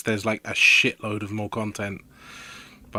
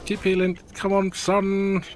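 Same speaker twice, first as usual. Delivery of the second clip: urging healer; 8 semitones higher, slower than usual. Real, not acted. Urging healer during boss fight.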